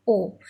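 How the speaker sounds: "O" said alone as one long-o sound; demonstrated vowel. Shown as a demonstration. Teacher showing how O A sounds together, isolated "O". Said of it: "O A is together sounded as".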